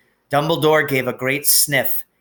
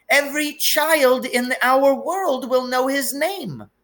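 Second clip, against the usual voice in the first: put-on high, anxious older woman, voice on the edge of breaking; worried Scottish professor pouring out bad news; trembling McGonagall; 11 semitones higher, 3 dB louder than usual. A narrator's reading. McGonagall figure held.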